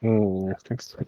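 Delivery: very quiet, reluctant mumble of agreement; unenthusiastic. Real, not acted. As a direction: agree under your breath with zero excitement. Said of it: "Don't sound so excited".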